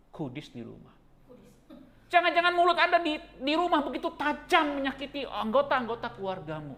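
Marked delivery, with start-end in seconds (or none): 2.1-6.7 loud, high sermon rebuke; preaching at full force. Real, not acted. Preacher warning the congregation about sharp tongues, loud preaching. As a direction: hammer the point home from the pulpit.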